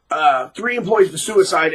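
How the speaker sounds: ordinary delivery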